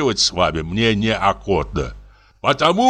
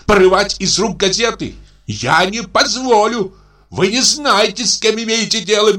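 pompous official in high, outraged voice; a bank director puffing himself up in high indignation; indignant bank director; 10 semitones higher than usual. Acted.

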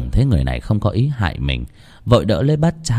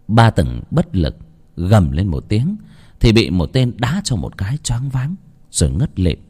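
plain reading voice

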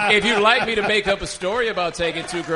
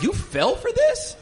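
high, slow, disbelieving squeak; mock incredulous voice; slower than usual. Co-host mocks the listeners' reaction in a squeaky voice. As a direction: stretch it out in shocked mockery.

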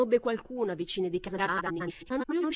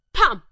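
sharp loud bang; 5 semitones higher, 14 dB louder than usual. A narrator's reading. Narrator voicing a blow. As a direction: slam it out.

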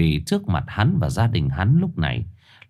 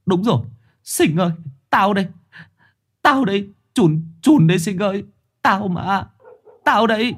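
much higher anguished pleading; desperate plea; 12 semitones higher than usual. Acted. Chồn begging Sinh to recognise him, desperate. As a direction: beg your friend to recognise you.

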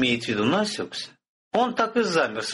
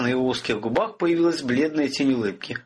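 ordinary delivery

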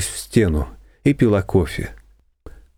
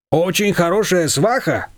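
a hint of a chatty shop owner, higher and slow; lightly voiced confectioner; 7 semitones higher than usual. A narrator's reading. Confectioner cutting into the talk, lightly voiced and slow. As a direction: butt in, slow and a bit higher. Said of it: "вмешалась кондитерша".

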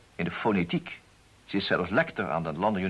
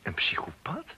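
shocked whisper; 6 semitones higher, 4 dB quieter, slower than usual. Real, not acted. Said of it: "whisper heard".

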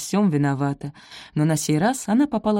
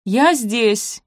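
higher, louder call; 6 semitones higher, 5 dB louder than usual. A narrator's reading. She answers from another room, calling out. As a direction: call out that you're here. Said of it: "крикнула она".

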